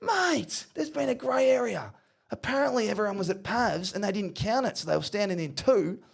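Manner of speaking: high-pitched coach impression; high mock-coach phone manner; Ross Lyon. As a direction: give the player news in a high comic voice. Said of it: "And he goes".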